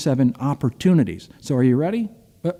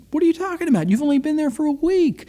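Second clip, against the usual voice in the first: much higher-pitched incredulous retort; acted disbelief; 11 semitones higher, faster than usual. Replying to friend quitting after a week, acted incredulity. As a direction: retell a baffled comeback, pitched way up.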